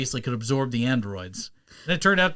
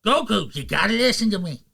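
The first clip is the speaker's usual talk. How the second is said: high, urgent cartoon mentor; put-on cartoon teacher's warning; King Kai; 8 semitones higher, 3 dB louder than usual. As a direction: plead in a quirky, raised voice. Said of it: "I've got his voice".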